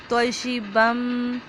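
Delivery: तोशिबम slow, a full heavy तो, the बम merged with a doubled मीम; heavy ta demo. Shown as a demonstration. Teacher models तोशिबम slowly, heavy तो. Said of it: "बा को मीम के साथ मिलाएंगे".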